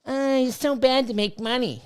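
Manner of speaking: mocking critics. Mocking people who say making money is bad, in a light put-on voice. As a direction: parody the naysayers, lightly. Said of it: "the fuck's wrong with you people".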